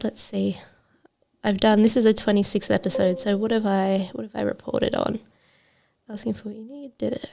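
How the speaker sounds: soft, absent-minded muttering while checking; quietly skimming through a list; talking to oneself. Real, not acted. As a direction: think aloud under your breath. Checks past episodes, talking to herself.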